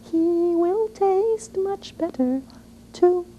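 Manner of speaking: very high, slow begging squeak; tiny pleading goat. Acted. Smallest goat begging the troll not to eat him, high squeaky plea. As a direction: plead in a tiny, frightened little voice.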